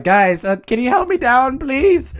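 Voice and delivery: high, rising plea for help; Velma. Acted. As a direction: plead in a put-on high voice. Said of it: "Velma's just like".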